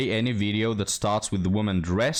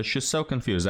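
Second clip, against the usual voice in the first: ordinary talk, nothing marked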